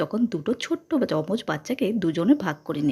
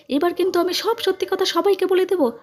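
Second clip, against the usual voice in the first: indignant shout; 10 semitones higher than usual. A narrator's reading. Aunt threatening to tell everyone the truth, high outcry. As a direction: burst out with a high, sustained threat. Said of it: "চিৎকার করে বলল".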